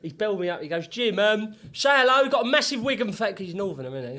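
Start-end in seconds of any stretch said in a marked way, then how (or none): 0.9-3.3 loud phone call. Retold or imitated.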